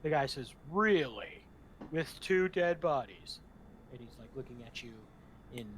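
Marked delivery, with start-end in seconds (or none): 0.7-3.4 loud, slow, doubting guard; sceptical guard. Acted. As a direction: drag out the disbelief, loudly. Guard doubting the party's story.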